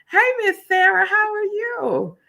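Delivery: a bright high hello to a friend; high excited greeting. Real, not acted. Host calling out to a viewer, high and excited.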